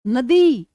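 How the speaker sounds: नदी, ending ई stressed and long. Shown as a demonstration. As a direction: lean on the ई at the end of नदी. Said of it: "ई की ज्यादा आ रही है".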